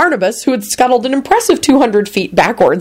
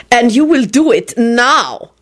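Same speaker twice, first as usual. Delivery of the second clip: slow, higher-pitched command; venomous; 5 semitones higher, slower than usual. A narrator's reading. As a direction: drive each word home with venom. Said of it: "reiterated venomously".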